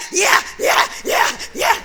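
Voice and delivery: loud laughing yeah yeah; a doctor cracking up at a patient's joke. Retold or imitated. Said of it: "He stopped and was like".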